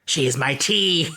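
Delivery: "She is my tea" slow in a villain voice; panto villain. Retold or imitated. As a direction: sneer "tea" like she means to eat her. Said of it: "I always thought she said".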